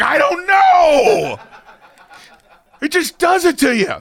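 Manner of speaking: high, loud, drawn-out mock wail; exaggerated high cry of bafflement; mock wailing shout. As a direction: wail it helplessly, hammed-up. Retelling shared tearful outburst in cinema.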